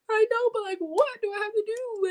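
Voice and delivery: put-on high-pitched child's whine; whining. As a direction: whine it, pleading with mom. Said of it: "I'm still whining".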